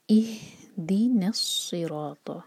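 इहदिनस्सिरात slow, न joined straight to स; recitation demo. Shown as a demonstration. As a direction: blend इहदिनस्सिरात with no ल. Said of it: "हम पढ़ेंगे". (इहदिनस्सिरात जोड़कर पढ़ें)

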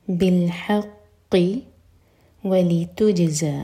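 very slow, with a bounce on the jim of ولتجزا; qalqalah on jim. Shown as a demonstration. Teacher demonstrating qalqalah to students, slow. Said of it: "جیم پر قلقلہ".

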